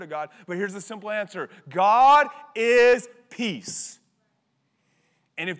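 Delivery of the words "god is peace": loud and very slow, every word weighted; emphatic punchline. Acted. Preacher answering young people, a loud slow emphatic punchline. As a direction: land it like the final answer.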